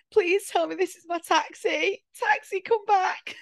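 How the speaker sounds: high tearful plea, hammed up; imitating her own drunk sobbing; mock drunken wail. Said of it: "just sobbing".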